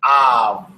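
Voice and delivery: "Av" called out strongly, open ah then v; modelling pronunciation. Shown as a demonstration. Teacher models the word for students, called out loud. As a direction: model "Av" loud and clear for the class. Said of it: "which sounds like V".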